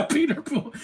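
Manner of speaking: high giggle through the name; laughing. Real, not acted. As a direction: laugh while saying it. Laughing at a character.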